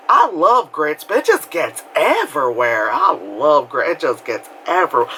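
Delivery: chatty southern lady imitation; imitated southern accent. Very nice lady chatting at breakfast, imitated southern accent. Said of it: "like, Southern people".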